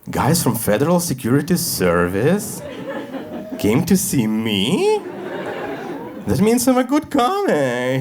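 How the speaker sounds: slow, mock-proud disbelief; mock flattered. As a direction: savour it like a compliment. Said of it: "And I go".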